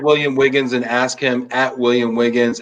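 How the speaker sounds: usual speaking voice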